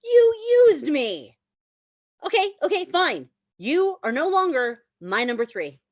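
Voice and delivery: loud whiny protest from the car; hurt outburst. Acted. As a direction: wail it like a dumped boyfriend. Ted hurt, dumped by Annie.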